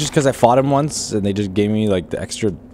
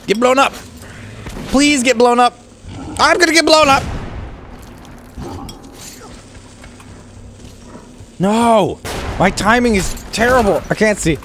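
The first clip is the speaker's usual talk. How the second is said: loud, much higher frantic shouts; frantic yelling; 11 semitones higher, 5 dB louder than usual. Real, not acted. Player caught in the blast, frantic yelling. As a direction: yell in panic. Bombing and getting hit in fight.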